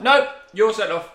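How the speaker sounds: loud, high, drawn-out refusal; firm referee. Retold or imitated. Imitating referee refusing to reconsider, loud and firm. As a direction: overrule him with mock authority.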